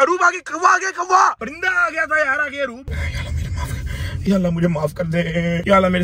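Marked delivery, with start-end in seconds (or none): 0.0-2.9 overacted shout. Passenger shouts a fake warning about a crow. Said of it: "over acting की वजह से". 2.9-4.2 whispered, slow, hammed-up plea to God; mock whispered prayer. Scared passenger whispers a mock prayer to God. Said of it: "over acting की वजह से".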